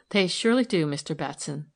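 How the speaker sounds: usual speaking voice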